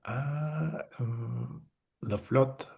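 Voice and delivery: soft, halting, at a loss; hesitant mock bafflement; puzzled hesitation. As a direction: mimic someone searching for an answer, softly. Acting out a puzzled listener.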